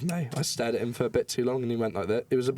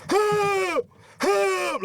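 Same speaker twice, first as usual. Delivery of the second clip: very high, loud pleading shout; mock cry for help; 5 dB louder than usual. Narrator pleading to a celebrity through the bus window, shouting high. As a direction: yell for rescue through the glass. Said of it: "I just go".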